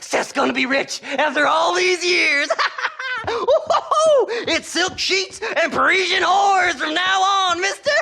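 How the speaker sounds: giddy greedy prospector; cackling with glee. Acted. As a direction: gloat and cackle like a rich man. Seth cackling, rich dreams.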